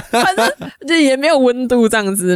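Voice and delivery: high laugh, then words through laughter; giggling it out. Real, not acted. Laughs at her own excuse. (笑着说)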